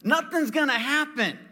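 high, brushing it off; mock carefree dismissal; dismissive. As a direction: scoff at the worry. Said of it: "I'm like".

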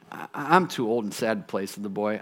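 low, soft, halting reply; sad, old boy. Acted. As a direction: decline with a touch of old man's sadness.

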